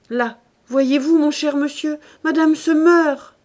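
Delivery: high, loud reproachful alarm; crying out. A narrator's reading. Maid telling master his wife is dying, crying out. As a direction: call out in alarm, drawing it out. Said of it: "cria Nanon".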